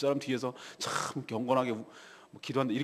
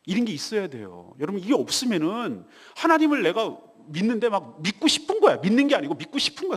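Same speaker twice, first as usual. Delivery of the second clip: ordinary delivery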